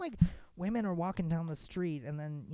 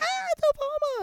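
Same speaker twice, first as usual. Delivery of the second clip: much higher, loud thrilled cry; excited crowd; 8 dB louder than usual. Retold or imitated. The crowd spots Obama arriving, thrilled and much higher. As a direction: squeal as the crowd does when he appears.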